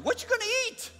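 very high, loud mock-mother cry; alarmed Italian mother. Italian mother hearing son will skip Thanksgiving, alarmed shriek. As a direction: shriek the question like a worried mother. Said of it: "Her first question".

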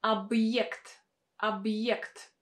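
объект slow, with y and e after the b. Shown as a demonstration. Teacher modelling объект, y after hard sign. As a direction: say объект as ab-yekt, y glide clear. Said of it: "pronounced as a combination of y and e".

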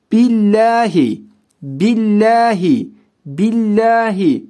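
billahi read with a light, thin lam. Shown as a demonstration. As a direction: say billahi with the lam clear, not heavy. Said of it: "Bakın ince okuyorum".

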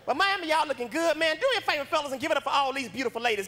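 ordinary talk

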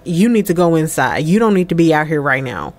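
low, firm fatherly command; retold stern order. Dad sending his daughter inside, mildly stern.

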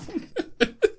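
high, quiet giggle; small high-pitched laugh; soft giggle. Real, not acted. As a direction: giggle softly at yourself. Laughs at own excitement.

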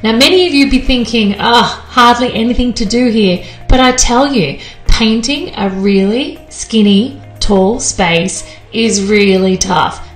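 loud, upbeat show narrator; TV voiceover. A narrator's reading. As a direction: announce it like a TV host.

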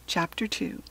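plain reading voice

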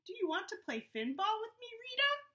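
excited high little-shark voice; Gilbert asking. Acted. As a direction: rush out a hopeful question. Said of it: "he asked".

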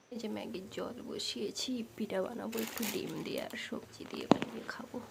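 whispering with a sick, gone voice; barely any voice left, just breath; lost voice. Real, not acted. Sick mother telling viewers she can't talk, whispering. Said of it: "গলা বসে গেছে".

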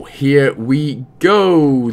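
normal talking voice

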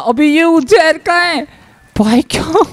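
real gamer's indignant cry; agitated protest. Real, not acted. Protesting after being shot in game.

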